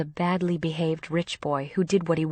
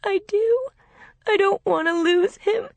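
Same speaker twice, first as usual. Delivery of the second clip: high, loud tearful wail; distraught, high-pitched crying voice; sobbing; 6 dB louder than usual. A narrator's reading. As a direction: plead through sobs, pitched way up. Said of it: "then started to sob".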